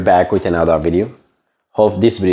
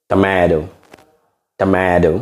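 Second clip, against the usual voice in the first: American Tomato with the T softened to a D; demonstrating American pronunciation; American accent; slower than usual. Shown as a demonstration. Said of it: "In American accent".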